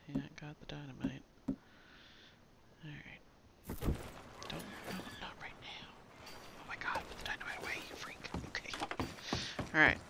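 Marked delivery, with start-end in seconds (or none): 0.1-8.7 hushed whisper under breath. Real, not acted. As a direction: whisper it so nobody hears. Whispering during sneaky game moment.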